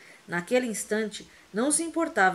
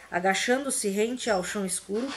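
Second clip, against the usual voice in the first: ordinary delivery, nothing marked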